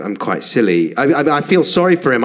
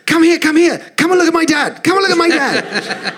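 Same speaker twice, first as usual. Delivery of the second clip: put-on high, squeaky little-girl voice, rushed and eager; excited little daughter. Dad imitating his five-year-old daughter calling friends, high and excited. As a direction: play a five-year-old dragging her friends over. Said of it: "she just said to them".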